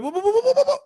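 rapid-fire, high, loud whoas; retold shock, words tumbling out; startled whoas. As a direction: burst out in a flurry of alarm. Retelling being yanked out of trance.

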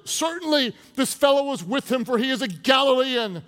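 high, loud accusing voice; insistent accusation. Acted. Bystander accusing Peter of being with him, insistent accusation.

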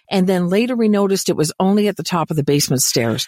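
ordinary talk

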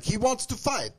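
high-pitched mock player voice; mock quote. Retelling a tennis player's bathroom-break flip-out, high mock voice. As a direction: put on a high, agitated player's voice. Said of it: "And he was like".

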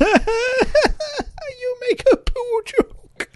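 very high, slow, laughing put-on voice; silly mock voice. Co-host teases about toilet joke, silly high voice. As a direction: squeal it slowly through laughter.

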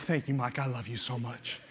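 very low, soft private thanks; hushed aside. Real, not acted. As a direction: drop right down for a quiet word to a friend. Private aside to his helper.

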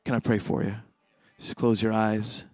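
soft, low altar call; prayer invitation. Acted. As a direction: quietly draw them into prayer.

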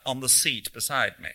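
ordinary delivery

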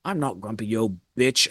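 low, soft grumpy comeback; sullen retort. Retold or imitated. Maid talking back to the mom, sullen. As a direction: imitate talking back under your breath, low.

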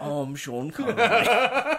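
high, loud, drawn-out silly impersonation; wildly off film-star voice; Sean Connery. Acted. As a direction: blow up the introduction into absurdity. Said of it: "You don't sound like him".

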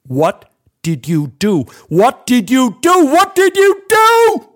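stunned murmur rising to a high furious shout; building to a scream. Acted. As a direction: repeat it, climbing from disbelief to a howl. Mark discovers plants ruined.

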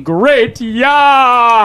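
drawn-out build into a high yell; hyped-up announcement. Pretend. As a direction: stretch it out, then whoop. Host's over-excited plug ending in a yell.

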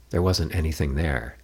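plain reading voice

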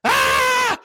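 very high, loud mock cheer; crowd cheer. Crowd reacting as Mick shakes his hero's hand, loud cheer. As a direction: roar like the whole crowd celebrating. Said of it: "everybody was like".

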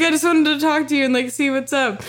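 high, whiny tearful plea; mock crying. Imitating herself explaining to her ex, mock crying. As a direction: rush it out in a fake weepy voice. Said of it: "I'm literally crying".

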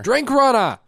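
mock bartender's yell, high and loud; imitated yell. Cohost imitating the bartender calling her runner, yelling. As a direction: holler it like a bartender calling staff. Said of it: "and then yell".

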